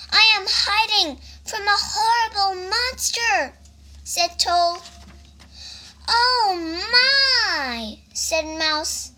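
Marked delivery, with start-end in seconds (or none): 0.1-3.6 anxious toad. A narrator's reading. Frightened Toad explains to Mouse. 6.1-8.0 high, drawn-out storybook dismay; high mock-fearful wail; dismayed cry. A narrator's reading. Mouse hears of monster.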